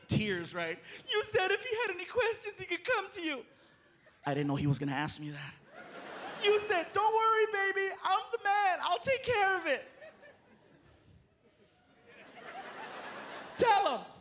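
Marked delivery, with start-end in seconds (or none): 1.1-3.5 high, weepy woman; high teary complaint; tearful girlfriend. Retold or imitated. 6.4-14.1 high, fast indignant woman; high hurried outrage; angry girlfriend voice. Retold or imitated.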